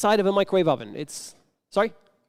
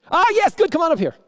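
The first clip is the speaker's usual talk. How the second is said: much higher and louder, delighted; happy host calling a volunteer up; excited welcome; 9 semitones higher, 5 dB louder, faster than usual. Real, not acted. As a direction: greet the volunteer with a burst. Volunteer steps up from audience.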